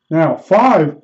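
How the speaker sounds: ordinary talk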